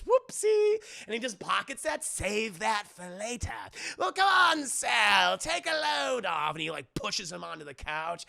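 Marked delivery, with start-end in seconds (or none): 0.0-0.8 high, loud mock oops; theatrical squeal; Joker. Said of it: "And he goes". 2.0-6.5 loud, high jovial villain; booming cartoon villain host; Joker. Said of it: "And he goes".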